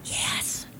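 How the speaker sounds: tiny whispered no; hushed child's whisper. Retold or imitated. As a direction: whisper it like a small child hiding. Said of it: "And still whispering".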